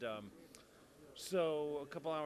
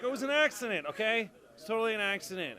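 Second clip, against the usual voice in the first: high, loud insistence it was an accident; loud defensive plea; 8 semitones higher, 10 dB louder than usual. Retold or imitated. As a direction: protest loudly, hands up. Speaker pleading with angry Joe.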